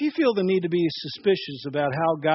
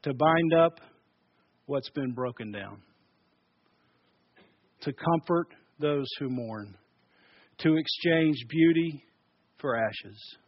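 solemn scripture reading; 5 semitones lower, 4 dB quieter than usual. Shown as a demonstration. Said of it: "this is what he says".

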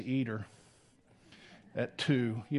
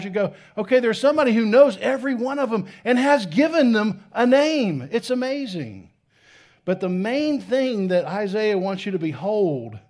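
louder, higher, building excitement; fervent preaching; 7 semitones higher, 8 dB louder than usual. Acted. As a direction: preach it with wonder at full force. Excited preaching about the stars.

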